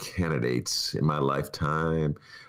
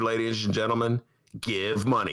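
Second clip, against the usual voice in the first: ordinary delivery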